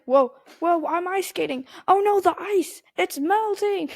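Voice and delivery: high excited panic; playful panicky superhero voice; Human Torch quote. Retold or imitated. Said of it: "he's like".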